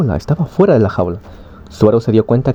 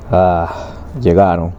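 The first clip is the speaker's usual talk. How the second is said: lower, offhand mumbled reply; very disinterested; 4 semitones lower, slower than usual. A narrator's reading. Rei notes the beasts arriving, very disinterested. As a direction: drop the pitch, sound uninterested. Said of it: "muy desinteresado".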